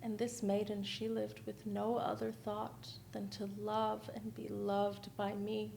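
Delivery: ordinary delivery, nothing marked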